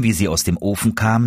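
plain reading voice

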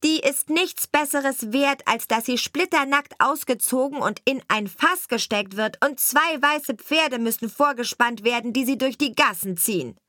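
the false bride pronounces a harsh sentence, mildly gloating. Acted. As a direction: condemn with smug relish. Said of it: "Da sprach die falsche Braut".

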